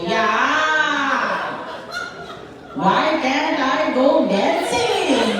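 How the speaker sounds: high, drawn-out sing-song mockery; dismissive mocking repeat. Mom repeats daughter's argument, dismissive high-pitched mimicry. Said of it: "just repeat my argument dismissively".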